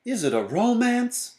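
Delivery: very high, hopeful little voice; high-pitched childlike question; child character's voice. A narrator's reading. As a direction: ask it high, like a dreamy child. Baby asks about the movie.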